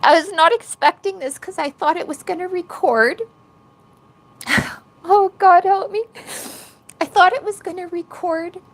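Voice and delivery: high, choked-up tearful voice; really upset, voice pushed up high; emotional, tearful. Real, not acted. Streamer caught off guard on camera, emotional and tearful. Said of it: "got emotional just now".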